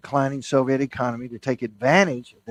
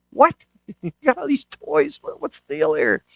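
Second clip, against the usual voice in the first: puzzled psychiatrist; 4 semitones higher than usual. Retold or imitated. Psychiatrist puzzled by crying boy.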